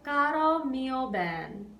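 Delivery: slow, careful Italian "Caro mio ben"; Italian title spoken. Shown as a demonstration. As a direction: say "Caro mio ben" slowly, each syllable clear. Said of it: "Can we say the title of this song together?".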